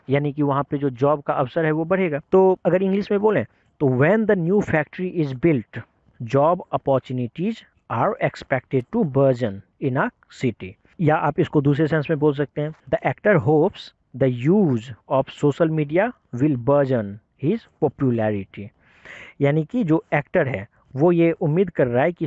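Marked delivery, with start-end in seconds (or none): 4.0-10.7 slow, careful English reading; slow example sentence. Shown as a demonstration. Reads English example sentence slowly. 12.9-18.7 slow, careful English reading; slow example sentence. Shown as a demonstration. Reads English example sentence slowly.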